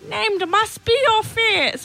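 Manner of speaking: high, slow attempt at a character voice; badly faked creature voice, drawn out and high; Yoda. Host who says she can't, attempting a Yoda impression. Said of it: "I can't do the Yoda voice".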